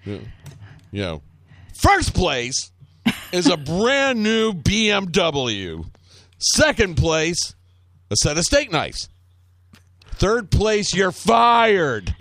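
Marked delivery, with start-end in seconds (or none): 1.7-12.1 high, slow, forceful sales speech; hammed-up hard boss announcing the stakes; Alec Baldwin. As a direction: lay out each prize slowly, building to a loud finish. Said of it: "Fucking Alec Baldwin in that".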